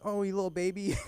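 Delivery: high-pitched cooing taunt; mocking baby talk. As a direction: coo it mockingly, as if to a tiny baby. Mocking short people, acted taunt.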